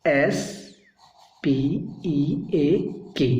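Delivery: letter by letter, slowly; spelled out slowly. Shown as a demonstration. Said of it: "spelling S P E A K".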